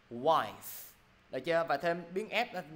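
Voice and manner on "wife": WIFE ending in an audible fff; final F pronounced. Shown as a demonstration. Teacher modelling for students, WIFE with its final f sounded. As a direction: release the f clearly in WIFE. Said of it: "lúc nào cũng phải phát âm ra".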